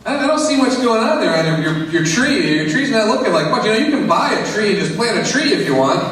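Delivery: lightly voiced skeptical neighbor; doubtful neighbor. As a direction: needle him about the bare tree.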